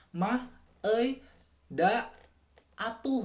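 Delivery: high, punchy Sundanese accent; Sundanese particles. Shown as a demonstration. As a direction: call each ending out in Sundanese style. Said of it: "orang Sunda itu biasanya".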